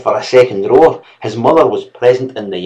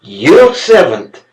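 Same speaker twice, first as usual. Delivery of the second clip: slow, louder scornful sneer; contempt; 5 semitones higher, 5 dB louder, much slower than usual. A narrator's reading. Servant scornfully mocking the mistress. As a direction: scoff at her word. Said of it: "cried Gilmer in contempt".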